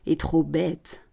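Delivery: slow, drawn-out scoff; sneering. Acted. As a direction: draw it out with quiet contempt. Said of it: "Le promoteur ricane". (ricanement lent et méprisant)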